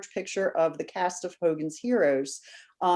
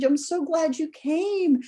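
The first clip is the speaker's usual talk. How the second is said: glad welcome; 10 semitones higher, slower than usual. Retold or imitated. Greeting the fan warmly.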